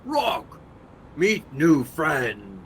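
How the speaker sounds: loud, slow creature shout; loud creature call. Acted. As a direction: bellow it out like a creature.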